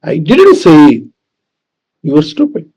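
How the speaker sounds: "say" held, the ending let drop; stress on SAY. Shown as a demonstration. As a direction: stretch "say" and stop before the rest. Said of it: "depending on which word is emphasized".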